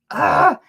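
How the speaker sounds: loud retold "ugh"; imitated groan of dismay. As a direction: groan out your dread. Retold reaction to doctor's news.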